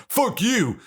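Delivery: high-pitched dismissive retort; mock-defiant squeal; mock defiance. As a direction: act out a scornful brush-off, pitched high. Voicing Generation Selfish's dismissal.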